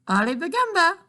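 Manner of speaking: high, loud squawky bird voice; parakeet voice. Acted. Father reading comics, imitating the parakeet Dolores. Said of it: "in the voice of Dolores".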